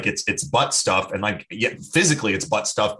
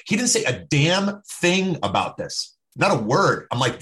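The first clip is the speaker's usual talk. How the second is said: ordinary delivery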